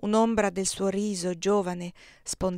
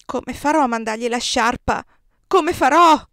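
high, piercing shriek, much louder; anguished scream; 5 semitones higher, 11 dB louder than usual. A narrator's reading. Maria screaming to the emperor in anguish. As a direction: cry out in despair. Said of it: "L'urlo di Maria".

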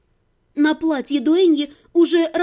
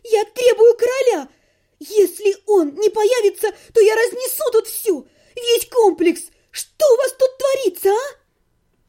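high, loud hysterical yell; girl screams for the king amid the chaos; shouting demand; 8 semitones higher, 4 dB louder than usual. A narrator's reading. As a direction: demand the king at the top of her voice.

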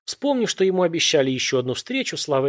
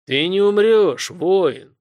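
smirking elder god; 6 semitones higher, 6 dB louder, much slower than usual. A narrator's reading. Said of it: "усмехнулся старшой".

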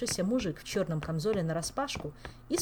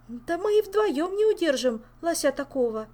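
flustered; 11 semitones higher, 8 dB louder than usual. Acted. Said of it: "растерялась Ольга".

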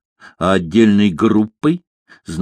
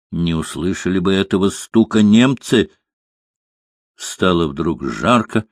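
ordinary delivery, nothing marked

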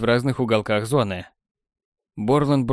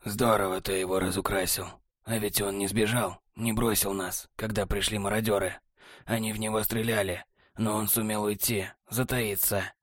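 lower, much softer, cool and smug; cool technician; 3 semitones lower, 6 dB quieter than usual. A narrator's reading. Technar answering Borland, low smug and soft.

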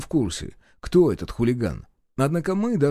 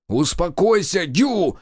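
higher, raised-voice command; sharp, urgent order; barked order; 6 semitones higher, slower than usual. A narrator's reading. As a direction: bark at your wife to calm down. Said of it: "рявкнул я".

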